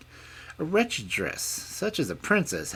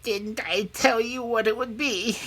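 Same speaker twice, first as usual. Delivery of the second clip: high, loud, grumbling hag; growling old hag; 7 dB louder than usual. A narrator's reading. Old hag answering the princess's plea, growling. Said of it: "growled the old hag".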